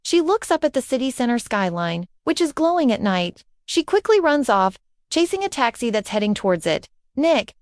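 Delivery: ordinary delivery, nothing marked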